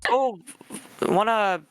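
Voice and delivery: high, laughing hammed-up rural Canadian; laughing Canadian accent. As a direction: play up the backwoods Canadian. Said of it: "what a Canadian accent sounds like".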